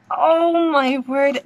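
slow, drawn-out amazement; awed. Real, not acted. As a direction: stretch it slowly in amazement. Reacting live to trailer.

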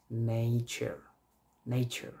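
নেচার with an added "i", "nei-char". Shown as a demonstration. Teacher modelling নেচার with the added i. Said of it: "নের সাথে আমরা যদি ইটা add".

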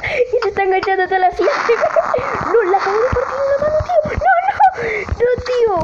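very high cry into frantic protest; high-pitched, incredulous gamer panic; shrieking disbelief. Real, not acted. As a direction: squeal in disbelief at the glitch. Absurd glitch grabs the player.

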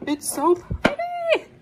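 high, slow squeal of delight; gushing squeaky voice. Acted.

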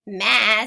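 slow, loud drawn-out maa; goat bleat. Acted. Reading to kids, a slow loud goat bleat. Animal sound in picture book.

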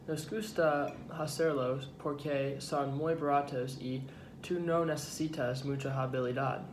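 normal talking voice

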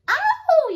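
high loud monster howl; sudden high wolfish howl. Acted. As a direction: let out a big howling "Ow!". Monsters howling in dungeon.

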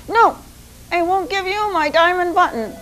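high rooster voice, refusing; stubborn small bird says no; defiant little rooster. Acted. Rooster refusing the Sultan a second time, high and defiant.

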